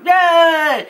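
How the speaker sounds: high, loud mock cheer; imitated crowd cheer. Everyone in the crowd answering the entertainer, mock cheering. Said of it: "everyone in the crowd goes".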